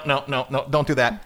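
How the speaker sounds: usual speaking voice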